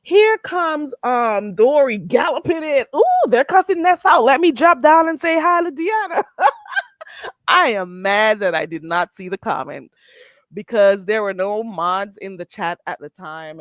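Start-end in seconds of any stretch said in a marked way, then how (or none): none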